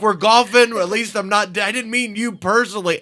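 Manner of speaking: nervous over-explaining; frantic backtrack. Retold or imitated. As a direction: scramble to take it back. Frantic backtracking apology retold.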